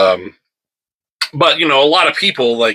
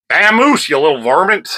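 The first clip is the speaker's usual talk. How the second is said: mock cowboy shoo; 7 semitones higher than usual. Shooing the cat away.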